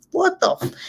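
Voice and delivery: high, cut-off what the f; stunned outburst. Retold or imitated. To the jogger flipping her off, a high cut-off shocked curse. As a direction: jolt up in pitch mid-curse, caught off guard. Driver quoting her own reaction.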